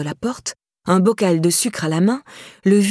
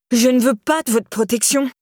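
cried out; 5 semitones higher than usual. A narrator's reading. Laura rejecting Myriam's protection, a fearful cry. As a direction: push her away with your voice. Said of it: "s'écria Laura".

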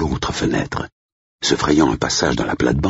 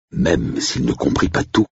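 usual speaking voice

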